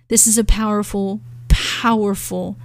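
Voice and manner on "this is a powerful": ordinary talk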